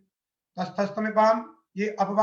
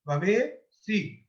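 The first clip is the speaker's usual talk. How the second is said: drawn out, syllable by syllable; slow spelled-out form; much slower than usual. Shown as a demonstration. Teacher building the form for students, slowly drawn out. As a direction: lay out भवेयसी slowly so students follow. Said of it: "य का तो लोप हो गया".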